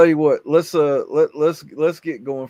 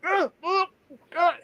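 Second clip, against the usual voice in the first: dazed groan. Retold or imitated. Friend stuck, glue-high, groaning.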